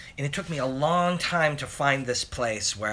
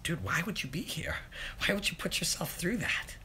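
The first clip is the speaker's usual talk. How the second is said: whisper; 5 semitones higher, 7 dB quieter than usual. Real, not acted. Mocking trolls who keep watching.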